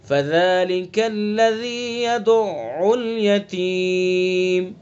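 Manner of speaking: slow, chanted tajweed recitation; Quran recitation. Shown as a demonstration. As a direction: recite it slowly with the ayn doubled. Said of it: "مشدد پڑھیں گے".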